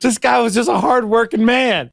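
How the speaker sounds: high-pitched put-on voice; mock indignant defender. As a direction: plead his case in a high comic voice. Jokingly acts out an indignant defender.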